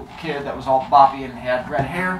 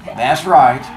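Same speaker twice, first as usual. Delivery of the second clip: measured, slow book reading; story narration; 4 semitones lower, slower than usual. Acted. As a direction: read the chapter to the class. Teacher reads novel aloud.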